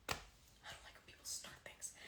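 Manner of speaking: hushed unvoiced aside; whisper. Real, not acted. Streamer whispering to chat about drama. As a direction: whisper it so nobody hears.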